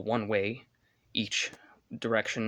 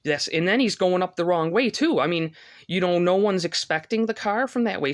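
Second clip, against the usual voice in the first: high, loud indignant rush; outraged; 7 semitones higher, 6 dB louder than usual. Real, not acted. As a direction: pitch it up, furious about the reckless driver. Outraged at wrong-way driver.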